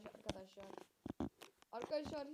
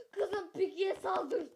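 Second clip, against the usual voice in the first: slow, loud crying plea; desperate wailing yell; 6 semitones higher, 12 dB louder, much slower than usual. Real, not acted. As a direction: wail the order.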